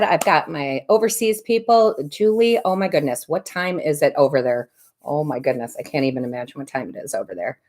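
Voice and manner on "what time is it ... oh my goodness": normal talking voice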